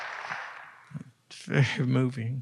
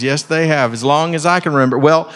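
ordinary talk, nothing marked

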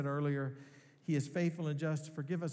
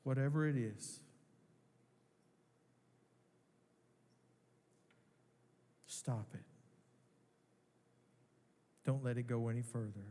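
gentle pastor guiding a time of prayer; prayerful reflection. Acted. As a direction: let each sin hang in the silence.

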